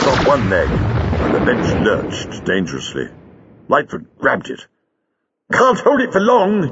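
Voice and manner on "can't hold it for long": high, urgent yell; strained yell. A narrator's reading. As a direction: yell for help under a heavy load. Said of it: "he yelled".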